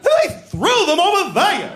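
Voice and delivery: wildly over-the-top, high, wailing impression; Ray Bolger's Scarecrow. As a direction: ham up an upset victim, slow and high-pitched. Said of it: "this performance that's way over the top".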